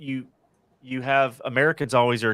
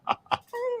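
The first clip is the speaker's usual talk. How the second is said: burst of laughter. Real, not acted. Laughs at accent remark.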